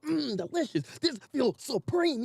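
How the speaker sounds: high, slow, soft savoring voice. Retold or imitated. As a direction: taste every word like a treat. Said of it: "I was like".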